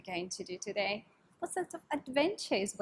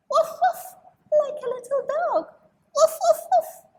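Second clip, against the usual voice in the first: high, loud yappy little dog; little dog barking; 8 dB louder than usual. Acted. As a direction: bark like a small excited puppy.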